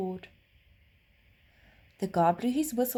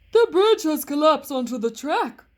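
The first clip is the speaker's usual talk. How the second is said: loud, higher anxious driver; train driver; 7 semitones higher, 11 dB louder than usual. A narrator's reading. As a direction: announce the bad news as a worried driver.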